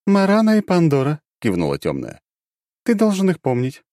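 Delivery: high, matter-of-fact; dark goddess reminding. Acted.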